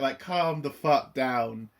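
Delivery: usual speaking voice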